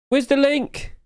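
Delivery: high-pitched plaintive squeal; mock panic. Streamer still hunting for the forum link, high mock panic. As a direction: squeal the question in mock distress.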